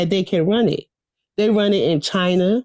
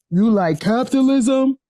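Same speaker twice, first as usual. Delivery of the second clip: higher, mocking cop question; 4 semitones higher than usual. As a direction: play the smug officer testing her. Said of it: "that's her cop voice".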